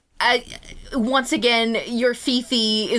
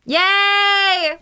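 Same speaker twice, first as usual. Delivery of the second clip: excited cheer; 10 semitones higher, 6 dB louder than usual. Acted. Character cheering.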